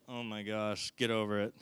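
low, soft exasperated aside; weary dad. Acted. Dad reacting to his son's dramatics, wearily dismissive. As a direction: sigh it out as a fed-up father.

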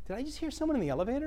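soft, distant, fast voice heard through elevator doors; a bystander outside, barely audible; muffled from outside. Retold or imitated. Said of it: "people on the other side say".

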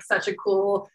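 ordinary talk

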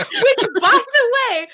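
giggling aside to friends; laughing. Real, not acted. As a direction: talk through a real laugh. Still laughing at the joke.

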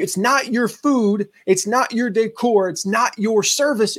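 slowed, emphatic telling her it's not hers; emphatic list. Retold or imitated. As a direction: drive home every not, one by one. Hammering home the point to her.

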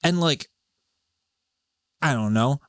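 usual speaking voice

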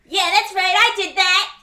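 loud, high gloat; triumphant shout. Real, not acted. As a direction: crow over the hit. Triumphant gloat after the roll.

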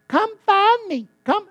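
high, loud, slow pleading bleat of a lost sheep; mock lost sheep. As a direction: play a helpless sheep begging to be found. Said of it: "a sheep that got lost and is going".

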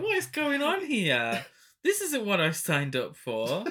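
mock confusion at a strange scene; baffled exclamation. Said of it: "I was just like".

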